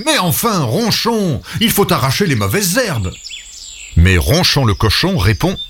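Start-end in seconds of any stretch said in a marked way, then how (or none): 0.1-3.1 higher exasperated scolding; a light cry of exasperation; Léon scolding his pig. Acted. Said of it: "Léon s'écrie".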